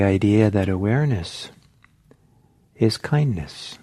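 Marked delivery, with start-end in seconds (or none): none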